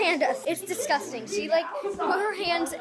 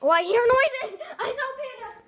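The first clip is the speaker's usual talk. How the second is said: excited; 10 semitones higher, 7 dB louder than usual. Real, not acted. Seeker announcing while searching.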